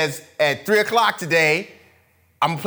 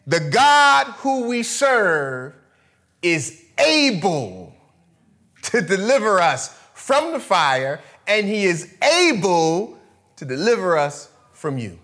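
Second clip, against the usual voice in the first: slow, raised, deliberate scripture; solemn reading; slower than usual. Acted. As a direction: proclaim each word slowly. King James reading.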